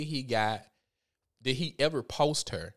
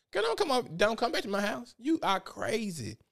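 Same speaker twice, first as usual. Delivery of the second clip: mock warning off; 9 semitones higher than usual. Telling liar boyfriend to stay away.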